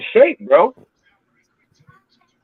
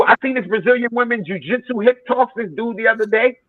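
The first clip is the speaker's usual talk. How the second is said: ordinary talk, nothing marked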